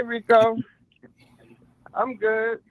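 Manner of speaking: ordinary delivery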